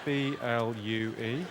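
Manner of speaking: low, soft, drawn-out letters; slow spelling out. Pretend. Comedian counting out a heckler's colour, slow deliberate spelling.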